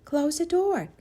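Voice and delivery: put-on high, bright little girl; young girl voice. A narrator's reading. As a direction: voice the girl hiding. Jaya voiced by narrator.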